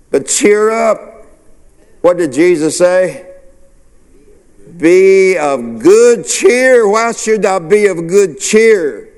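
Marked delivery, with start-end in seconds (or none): none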